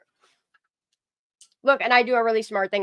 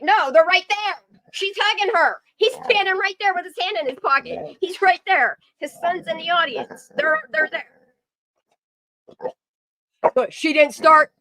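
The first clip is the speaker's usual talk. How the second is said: higher and louder, pointing it out eagerly; excited insisting; 7 semitones higher, 5 dB louder than usual. Real, not acted. Streamer insists to doubting viewers, excited and higher. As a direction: make them see it, it's right there.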